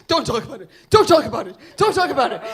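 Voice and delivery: forceful, mounting repeated order; urgent warning. Retold or imitated. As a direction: shut the subject down hard. Colleague shutting down the question.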